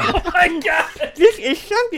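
bursting out laughing, spraying spit; very high, cracking into laughter; spit take. Real, not acted. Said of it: "You just spit everywhere".

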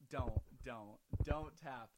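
loud and grossed out; loud disgusted don't. Real, not acted. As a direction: cut off the tapping sound loudly. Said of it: "Ew, ew".

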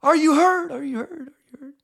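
high, loud call trailing off in echoes; mock cave echo; echoing yell. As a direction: shout down a hole, repeats dying away. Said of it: "I'll stop with the echoing".

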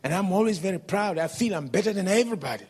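loud, fast, high angry boast. Retold or imitated. Preacher voicing the proud man's fast angry boast. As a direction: rattle it off in a proud rage.

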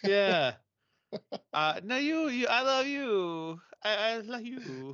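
high, slow, lovey-dovey mock voice; giggly late night call. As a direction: coo it like a smitten teen on the phone.

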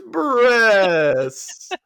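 long, drawn-out high cry; hammed-up gleeful holler; mock excited. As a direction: stretch it out loud and high. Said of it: "he turns back and goes".

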